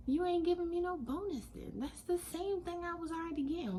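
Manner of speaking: high, incredulous pushback at the offer; indignant disbelief. Retold or imitated. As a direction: scoff at a bonus that isn't one. Retelling reply to boss offer.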